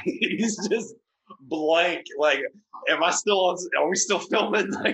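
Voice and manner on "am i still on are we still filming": high, loud bewildered mock voice; Mike Myers. Stunned co-host on live television, bewildered mock voice. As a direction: imitate a stunned co-host, much pitched up. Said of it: "Mike Myers' reaction is the best".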